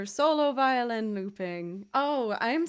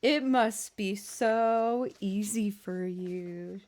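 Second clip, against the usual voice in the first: usual speaking voice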